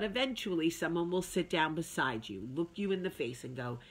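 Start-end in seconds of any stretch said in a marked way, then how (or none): none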